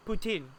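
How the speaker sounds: poutine with a plain short i at the end; accurate pronunciation. Shown as a demonstration. Teaching the accurate poutine, no French accent. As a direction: say poutine as poo-tin. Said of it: "The E does not make it an I".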